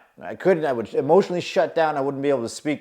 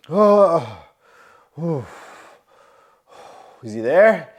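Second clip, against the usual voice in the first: ordinary talk, nothing marked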